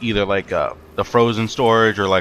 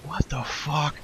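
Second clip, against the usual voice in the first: hushed, higher, drawn-out imitation of mouthing; mouthed silently; 7 semitones higher, 9 dB quieter, much slower than usual. Male manager to him over her head, imitating a mouthed question. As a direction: barely voice it, as if only mouthing. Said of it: "and he just mouths".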